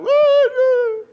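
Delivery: high, loud, drawn-out woo; shouted "woo". Acted. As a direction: call out a long high "wooo". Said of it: "then he shouts out".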